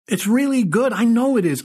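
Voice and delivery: gushing with delight; excited. Acted. Writer gushing over his script.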